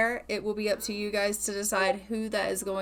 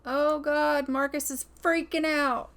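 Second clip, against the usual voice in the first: excited; 5 semitones higher, 4 dB louder than usual. Real, not acted. Babies coming, panicked.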